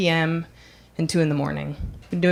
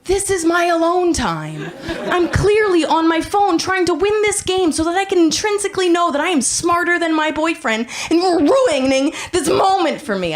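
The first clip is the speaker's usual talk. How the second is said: high-pitched, indignant comic tirade; exasperated rant; 10 semitones higher, 3 dB louder than usual. Acted. Woman in line ranting at an oversharer, exasperated. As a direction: rail at them in mounting disbelief, voice pushed high.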